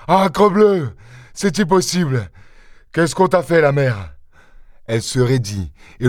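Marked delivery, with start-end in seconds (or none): 0.1-4.1 raised-pitch, dismayed exclamations; shocked outcry. A narrator's reading. Husband seeing his wounded wife, shocked outcry. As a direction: exclaim in disbelief, starting high and easing down.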